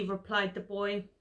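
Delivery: ordinary delivery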